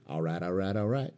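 lightly voiced Southern actor catchphrase, rising; Matthew McConaughey. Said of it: "Matthew McConaughey".

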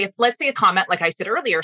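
normal talking voice

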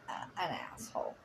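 quick hushed whisper. Real, not acted. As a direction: drop to a fast whisper for the rude word. Censoring a rude word.

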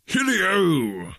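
put-on a jolly old man's high festive call; jolly cry. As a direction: call the party to begin. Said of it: "cried old Fezziwig".

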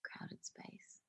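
whispered to herself; a private whisper while thinking. Real, not acted. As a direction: breathe the words with no voice. Repeats the clue.

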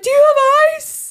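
high, drawn-out urgent plea; high-pitched mock alarm; frantic mom. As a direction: imitate a panicking mom calling out. Mom's urgent plea in the story.

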